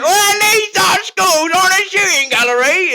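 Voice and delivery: put-on high, shouted, stretched-out British accent; full-on British accent. Gamer stuck in character with the boys, shouted British accent. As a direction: yell it in exaggerated British. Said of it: "going full on British".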